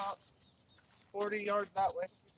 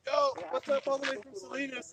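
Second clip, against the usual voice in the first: loud, hearty shout-out; hailing a streamer; 8 dB louder than usual. Acted. Viewer acting out a shout to a favorite band's live stream, calling out. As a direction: call it out like a fan greeting the streamer.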